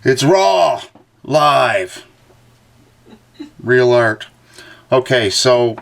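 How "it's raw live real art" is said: mock hype. Joking hype about his sketch.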